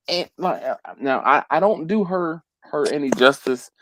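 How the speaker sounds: ordinary delivery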